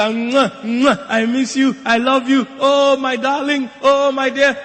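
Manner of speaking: high, loud gushing air kisses; airy gushing darling tone. Retold or imitated. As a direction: coo it like a gushing lady. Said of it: "they greet with compassion and emotion".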